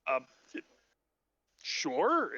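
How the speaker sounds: halting, reluctant agreement; lightly voiced uneasy dwarf; hesitant dwarf. Wary dwarf answers flamboyant stranger.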